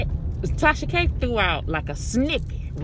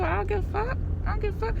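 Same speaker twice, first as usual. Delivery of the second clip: higher, repeated defiant dismissal; 11 semitones higher, faster than usual. Real, not acted. Telling critics she doesn't care, defiant. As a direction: toss it off defiantly.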